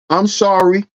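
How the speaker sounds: put-on sheepish boy mumbling sorry; lightly voiced scared teen; meek son. Son apologizing meekly.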